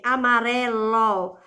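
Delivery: "amarelo" slowly, holding "re"; stress on penultimate syllable. Shown as a demonstration. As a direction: lean on the "re" in "amarelo". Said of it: "é a penúltima". ("amarelo" devagar, alongando o "re")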